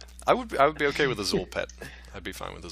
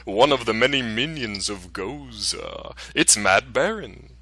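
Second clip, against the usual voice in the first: slow, drawn-out spooky host voice; low haunting voice; slower than usual. Acted. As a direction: ghoulish announcer, hammed up. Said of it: "I gotta put my spooky voice back on".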